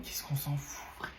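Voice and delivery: hushed scoff; whisper. Acted. Pupil scoffing at a classmate, whispered. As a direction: mutter it under your breath.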